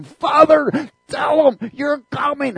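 high, loud pleading shout; crying out to the Father in the dream, high, loud, slow. Retold or imitated. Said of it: "crying out to the Father".

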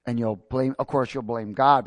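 ordinary talk

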